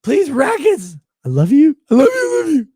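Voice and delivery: high pleading whine; mocking whiny beggar.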